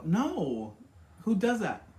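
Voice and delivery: who would ever do that; indignant disbelief. Real, not acted. Indignant reaction to quoted guy.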